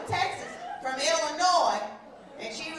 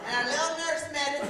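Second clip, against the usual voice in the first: ordinary talk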